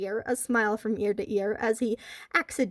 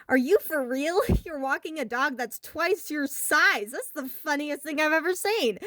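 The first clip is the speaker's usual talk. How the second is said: put-on sneering teen, laughing at him; mocking bully; 6 semitones higher than usual. A narrator's reading. Teen bully mocking a boy walking his dog, laughing sneer. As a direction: taunt the kid with a laugh.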